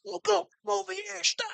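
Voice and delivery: high, strained mock shout of a quarterback barking orders; quarterback yelling orders. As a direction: play Brady hollering at his linemen. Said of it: "yelling at his linemen".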